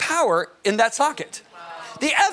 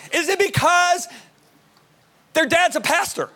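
higher, leading question; dad quizzing sons; 9 semitones higher than usual. Retold or imitated. Father testing his sons with silly options, leading. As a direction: pitch it up, baiting them for a no.

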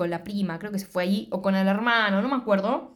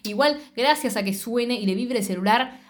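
normal talking voice

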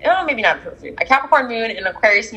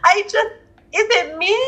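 mock self-doubt; 10 semitones higher than usual. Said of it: "And Pisces are like".

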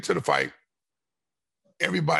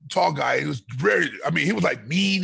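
usual speaking voice

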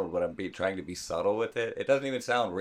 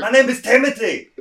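loud high goofy voice through a laugh; loud squeaky voice through a laugh; put-on high voice; 14 semitones higher, 11 dB louder than usual. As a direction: announce the name in a squeaky, laughing bark. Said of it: "you sound like Arnold".

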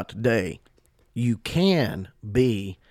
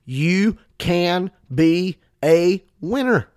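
slow, spaced-out words, "you ... can ... be ... a winner"; weight on every word, rising pitch on "winner"; emphatic; 4 semitones higher, 3 dB louder, much slower than usual. Real, not acted. Preacher assuring listeners they can win, emphatic. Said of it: "today I say emphatically".

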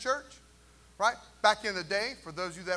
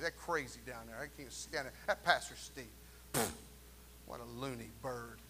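low, sneering gossip voice; mock gossiping churchgoer; 6 semitones lower, 6 dB quieter than usual. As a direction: lampoon a bitter member trashing another church.

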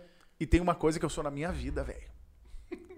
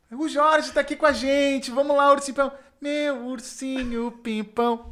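hammed-up drag queen; 8 semitones higher, 4 dB louder than usual. Drag queen dancing for Jorge on stage, high showy flirting. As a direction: perform it flirtily for the crowd.

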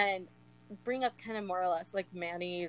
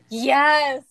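excited; 5 semitones higher, 12 dB louder than usual. Real, not acted. Thrilled fan gasping at favorite part.

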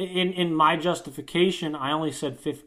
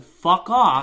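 louder, higher mock dismissal; retold defiance; 4 semitones higher, 7 dB louder than usual. Student tells the teacher off, retold defiant outburst. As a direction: reenact telling someone off. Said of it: "I said".

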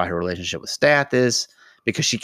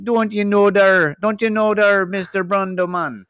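hammy Jamaican lilt, pitched way up; Jamaican accent; 12 semitones higher, 6 dB louder than usual. Pretend. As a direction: stretch it out in a comic Jamaican accent. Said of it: "like, a Jamaican accent".

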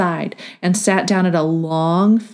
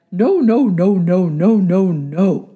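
loud, repeated no, falling away; a stubborn grass refusing; talking grass; 3 dB louder, slower than usual. A narrator's reading. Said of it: "And the grass said".

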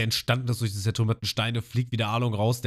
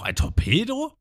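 startled Sanji; 3 dB louder, slower than usual. Retold or imitated. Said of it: "Und Sanji auch".

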